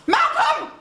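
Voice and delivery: high, loud shout of a name; shouted call. Acted. Calling out to Malcolm in a high shout. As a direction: call out the name at full volume.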